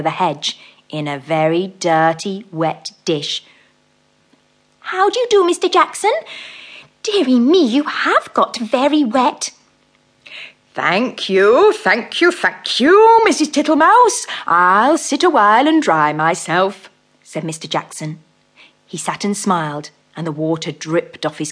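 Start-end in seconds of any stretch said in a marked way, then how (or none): 4.9-9.5 high, loud, prim mouse greeting; politely dismayed little mouse; polite fussy mouse. Acted. Mrs Tittlemouse greets the dripping toad, high prim mouse voice. 10.7-16.9 slow, fat, comfortable toad voice; fat-voiced toad. Mr Jackson makes himself at home, slow fat toad voice. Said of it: "coughing in a fat voice".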